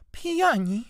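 gasp, then high startled exclamation; shocked stage whisper. A narrator's reading. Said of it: "szepnęła ciocia".

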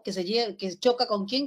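ordinary talk, nothing marked

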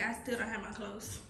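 ordinary talk

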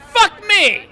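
high, loud yell of frustration; frustrated shout. Real, not acted. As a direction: scream it as the car spins. Car loops out of control.

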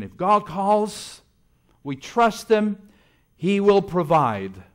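slow, loud and high, preaching the creed; slow ringing declaration of faith; firm declaration. Retold or imitated. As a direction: proclaim it like an unshakable vow. Voicing the parents' creed.

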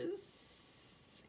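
high unvoiced hiss. Frightened child hiding under quilt, hissed whisper. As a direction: hiss the word like a scared snake. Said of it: "you hiss".

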